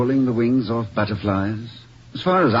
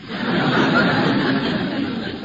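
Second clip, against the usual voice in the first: a warm amused chuckle; breathy amused laugh; hearty laugh; 2 semitones higher than usual. Real, not acted. [name] laughs at Vicky's joke.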